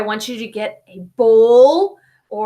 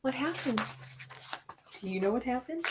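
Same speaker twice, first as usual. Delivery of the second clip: hushed wondering question; whispering; 16 dB quieter, faster than usual. Acted. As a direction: lean in and whisper it. Hushed realization.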